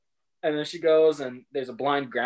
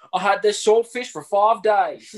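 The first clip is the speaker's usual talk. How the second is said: put-on Australian accent; 7 semitones higher, 4 dB louder than usual. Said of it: "Not Australian at all".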